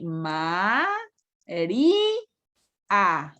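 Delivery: "Ma, ri, a" as three separate spoken syllables; split into syllables. Shown as a demonstration. Teacher showing the class how María splits, syllable by syllable. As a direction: chop María into ma-ri-a with pauses. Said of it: "¿Cómo la voy a separar aquí?".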